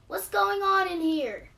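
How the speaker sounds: high, loud, slow demanding mom; mother rushing into the living room; alarmed mother. A narrator's reading. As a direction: burst in asking what happened. Mommy rushing in at screams.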